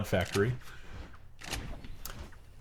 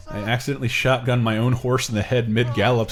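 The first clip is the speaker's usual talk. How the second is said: normal talking voice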